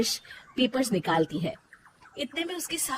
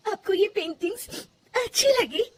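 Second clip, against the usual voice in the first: much higher teary question; tearful disbelief; 11 semitones higher than usual. Acted. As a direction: ask through leftover tears. Still tearful, surprised.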